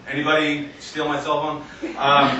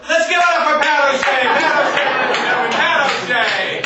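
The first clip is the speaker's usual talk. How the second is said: high, hyped comedy-club introduction; stand-up MC announcer; 10 semitones higher, 3 dB louder than usual. Acted. Voice in comedian's head after sex, announcer introduction. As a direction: announce the act to a roaring crowd. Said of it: "I hear this voice in my head go".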